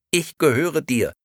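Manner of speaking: sweet, empty doll voice; talking doll. Acted. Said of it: "fuhr Bibi-Girl fort".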